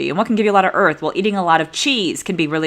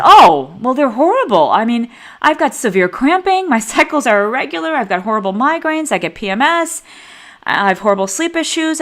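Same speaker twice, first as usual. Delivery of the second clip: loud drawn-in "Oh", then higher, emphatic list of complaints; exasperated retold patient; 5 semitones higher, 5 dB louder than usual. Patient telling her doctor about life off the pill, exasperated. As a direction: imitate a patient unloading every misery.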